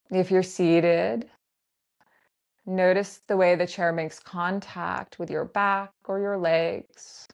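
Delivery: slow grounding-practice voice with long pauses; guided meditation. Acted. Teacher guiding listeners to land in the body, guided meditation. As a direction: lead them gently into noticing, unhurried and spaced out.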